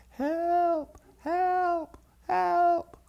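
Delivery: high, held-back cries from afar; tiny far-off calls for help; little distant voices. Retold or imitated. Daddy hears the boys' faint cries across the yards, distant. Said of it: "but then he heard it again".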